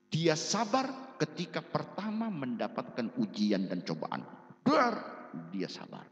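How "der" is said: loud, high verbal bang; sudden spoken impact noise; imitated crash. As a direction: burst it out like a blow.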